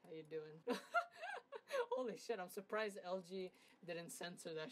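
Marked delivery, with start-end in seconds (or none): 0.7-1.9 cackling laugh up high; high wheezing laugh. Real, not acted. To viewers about the censor, a high wheezing laugh.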